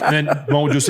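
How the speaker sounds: usual speaking voice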